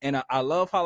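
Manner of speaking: usual speaking voice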